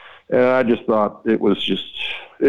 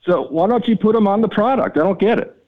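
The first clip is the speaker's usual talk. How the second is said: mock outrage, pitched up and pushing; retold exasperated rant; 10 semitones higher than usual. Retelling how he laid into the map company, raised and exasperated.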